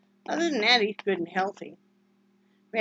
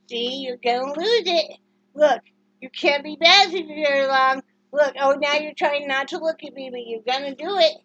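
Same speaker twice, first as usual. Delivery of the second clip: playful teasing; 5 semitones higher, 7 dB louder than usual. Real, not acted. Teasing dog into giving in.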